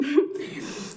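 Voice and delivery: breathy half-laugh, half-sob; real embarrassed laugh through tears; tearful laugh. Real, not acted. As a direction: let out a teary laugh at yourself. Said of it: "I didn't think I'd get emotional".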